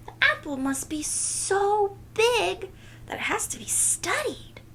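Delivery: put-on high, wondering girl voice; a girl amazed by her own idea; wide-eyed girl. Nellie imagining the huge apple, high and wondering.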